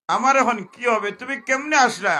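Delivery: high, loud sermon question; loud preaching. Acted. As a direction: cry out the question to the crowd. (চড়া গলায় ওয়াজ)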